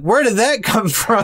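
high, laughing disbelief. Retold or imitated. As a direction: laugh through the question. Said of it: "And I'm like".

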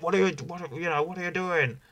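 high-pitched mock Sheamus; a hint of an outraged Sheamus. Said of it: "And Sheamus is like".

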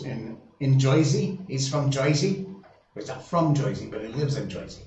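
put-on playful Jersey accent, "Joisey" for Jersey. Host joking about where his brother lives, hammed-up Jersey accent. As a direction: put on your best mock New Jersey accent. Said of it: "that's my best Jersey accent".

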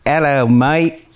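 slow, lightly put-on Australian accent; reading a listener's line in a light Australian accent. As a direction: drawl the greeting like an Aussie. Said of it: "Read it in an Australian accent".

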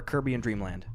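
"Caribbean" squashed into Kirby-an; joke mispronunciation. Shown as a demonstration. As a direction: say "Caribbean" as KUR-bee-un. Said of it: "I either hear people say".

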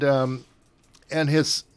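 ordinary talk, nothing marked

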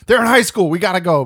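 loud, high imitated alarm; urgent warning. Man warning stoned friend to leave, mock urgency. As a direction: push it out in mock panic.

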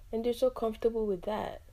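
ordinary talk

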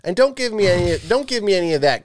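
high-pitched, fussy demand in mock outrage; mock indignant rant. Rejecting fancy salt as a demanding eater, mock indignant. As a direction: put on a worked-up customer's protest.

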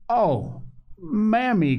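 put-on high-pitched little-child call; delighted small child; excited cry. A narrator's reading. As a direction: cry out eagerly to mother. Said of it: "cried Phronsie".

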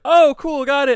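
high, loud, chirpy acknowledgement; loud mock-excited quote; excited reply. Acting out Sam's happy reply.

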